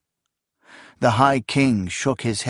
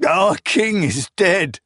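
anguished cry; 8 semitones higher, slower than usual. Acted. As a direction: announce the death in anguish. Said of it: "Akamian cried to the grim knights".

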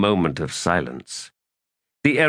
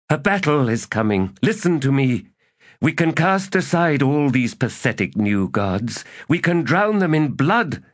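a lightly voiced errant, higher and insistent; scheming elder god; 9 semitones higher than usual. A narrator's reading. Errant to Knuckles, lightly voiced and insistent. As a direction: coax an old ally back to power.

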